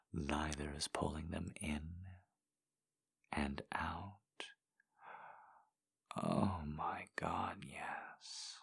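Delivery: hushed, intimate whisper. Acted. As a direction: whisper it close and slow. Intimate whispered roleplay throughout.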